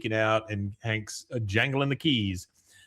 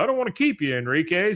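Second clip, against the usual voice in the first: polite brush-off; 6 semitones higher, faster than usual. Retold or imitated. Hank pushing him out.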